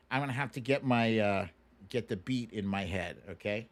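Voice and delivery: ordinary talk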